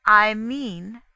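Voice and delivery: loud, deliberate opening. A narrator's reading. Poirot explaining to Inglethorpe, deliberate. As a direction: announce it firmly and deliberately. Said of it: "said Poirot deliberately".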